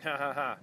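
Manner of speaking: short amused laugh at himself; quick ha ha ha, genuinely tickled; real laugh. Real, not acted. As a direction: laugh it off, caught out. Laughs at own slip.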